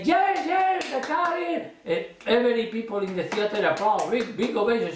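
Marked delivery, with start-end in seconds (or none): 0.0-1.6 much higher, bubbling delight; overjoyed. Shown as a demonstration. Magician on stage, overjoyed exclamation. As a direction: gush as if it worked. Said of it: "I say".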